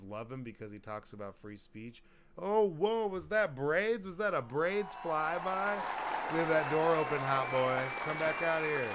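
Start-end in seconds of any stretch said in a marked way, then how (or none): 2.4-5.7 excited. Real, not acted. Sudden excitement at someone passing. 6.3-8.9 shouting across the house; calling out. Real, not acted. Calling out to someone leaving.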